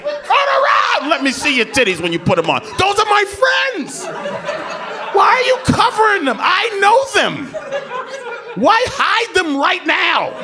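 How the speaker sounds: mock indignant yelling. Boyfriend yelling at girlfriend for hiding, hammed-up.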